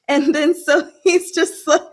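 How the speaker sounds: laughing through the words. Real, not acted. Amused by the flirting scene.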